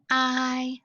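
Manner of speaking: "I" said alone as a long eye sound; isolated vowel sound. Shown as a demonstration. Teacher showing kids the y sound. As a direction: give just the "I" sound. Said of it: "Vowel Y".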